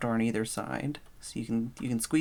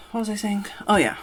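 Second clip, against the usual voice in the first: ordinary delivery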